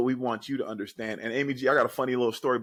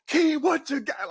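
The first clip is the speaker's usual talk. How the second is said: high, louder hyped call; teammates calling out; 5 dB louder than usual. Retold or imitated. Teammates before the game calling on Keem to speak, high excited call. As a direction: call him up like an eager teammate.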